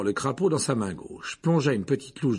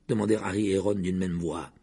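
usual speaking voice

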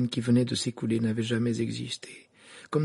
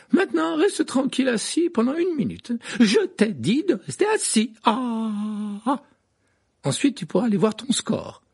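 high, firm orders; scolding nurse; 13 semitones higher, 4 dB louder than usual. A narrator's reading. Madame Pomfrey ordering Harry to sit, high scolding. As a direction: tell him to sit still.

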